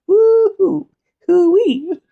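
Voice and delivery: high, loud whooping cheer; excited whoop. Real, not acted. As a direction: let out a giddy falsetto whoop. Excited cheer between topics.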